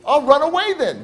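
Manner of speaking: put-on high, loud kid's comeback; acted childish defiance; defiant threat. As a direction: fire back like a stubborn child. Kid threatens back in argument.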